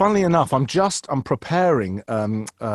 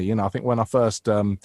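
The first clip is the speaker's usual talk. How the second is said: ordinary talk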